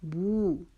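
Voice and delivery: बू held out as "booo"; measured model of a long बू; lengthened vowel. Shown as a demonstration. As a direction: stretch the बू for a beat. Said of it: "बू को हम एक अलिफ".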